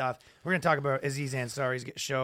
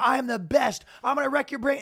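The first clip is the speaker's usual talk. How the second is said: cocky bragging; 9 semitones higher, 4 dB louder than usual. Retold or imitated. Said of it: "He's like".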